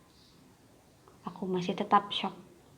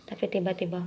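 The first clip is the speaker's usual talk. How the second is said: usual speaking voice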